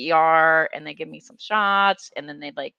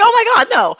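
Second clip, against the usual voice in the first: high, loud horrified outburst; appalled, rejecting the idea outright; shocked denial; 11 dB louder than usual. Retold or imitated. A teen answers the church leader who suspects self-harm, high and shocked.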